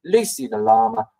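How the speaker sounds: ordinary talk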